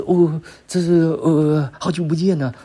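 fawning greeting. Retold or imitated. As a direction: ham up a timid suck-up greeting. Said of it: "他是那一种". (模仿讨好的寒暄，声音偏高)

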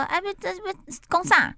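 put-on high-pitched Fujian-accented father; Fujian dad. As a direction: imitate a baffled Fujian dad in dialect. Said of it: "那爸爸是福建人".

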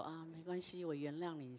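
a hint of a bashful mother, soft; lightly voiced shy mother forgiving; shy mom. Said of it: "妈妈就很腼腆的，呃，笑笑说". (轻轻模仿腼腆的妈妈)

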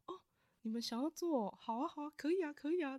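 lightly voiced, readily agreeing organizer; agreeable person in charge. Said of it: "然后那个负责人就说".

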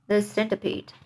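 usual speaking voice

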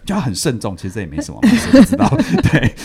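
normal talking voice

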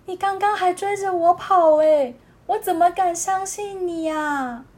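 high, sharp doubting voice; indignant otter. Acted. Otter holding up a shell, doubting the seal, high-pitched. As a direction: challenge him, not buying it.

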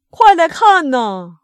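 loud, high, drawn-out call; excited shout. A narrator's reading. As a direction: call the whole village over, thrilled. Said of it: "激动地冲出屋子大喊道". (激动地拉长声音大喊)

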